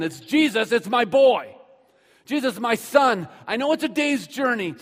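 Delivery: loud, high, urgent pleading; a father crying out for his dying son; desperate begging. Acted. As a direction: beg at full force, insisting over and over. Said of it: "he begged him or he pleaded".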